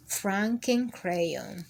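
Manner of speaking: usual speaking voice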